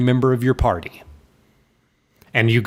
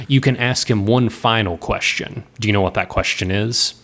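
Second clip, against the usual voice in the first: ordinary talk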